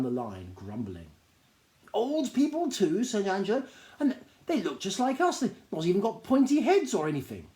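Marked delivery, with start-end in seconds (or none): 1.9-7.5 a high, eager little gnome voice, bursting with news; high excited gnome. Acted. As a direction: gnome tells a stranger about the store, high and eager. Gnome stares at newcomers.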